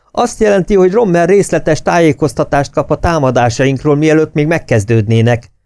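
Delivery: very high, loud raging officer; furious shout. A narrator's reading. Officer ranting that Rommel learns their plans. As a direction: keep yelling, near hysteria. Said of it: "ordított Bug".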